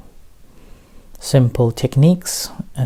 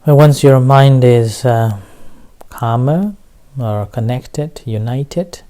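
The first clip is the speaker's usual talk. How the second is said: measured meditation teacher with long pauses; unhurried instructions, leaving room to breathe; guided meditation. Real, not acted. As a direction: guide listeners into relaxation, phrase by phrase.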